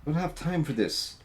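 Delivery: high, flustered urging; impatient NPC. Acted. Nervous crew member hurrying Vincent, high and flustered. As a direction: push him to hurry.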